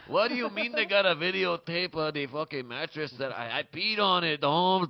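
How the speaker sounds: put-on protest, then reassure loudly in comic Mexican accent; mock Mexican accent. Calming his friend about a videotape, loud Mexican accent. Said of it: "speaks with a heavy Mexican accent".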